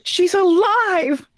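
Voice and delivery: high, slow, gasped out in mock shock; mock astonishment. Friend gasping as the dead character returns, mock shock. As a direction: act stunned she's back.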